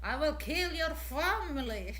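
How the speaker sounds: hammed-up gangster threatening a family, half laughing; scary Russian menace. Russian voice done as a loud, playful mock menace. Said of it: "the sound is so scary".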